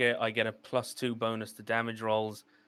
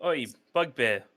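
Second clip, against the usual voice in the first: put-on loud Welsh accent, laughing; 4 semitones higher, 5 dB louder than usual. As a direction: shout it out like a Welshman. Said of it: "that's what Welsh does for you".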